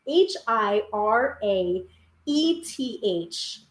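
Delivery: slow letters: H I R A E T H; careful slow spelling of the Welsh word; spelled out letter by letter. Shown as a demonstration. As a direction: spell H I R A E T H out one letter at a time, slowly. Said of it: "I don't know exactly how to pronounce this".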